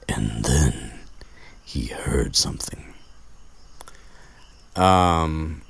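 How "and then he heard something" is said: suspense whisper. Real, not acted. Suspense in story retelling.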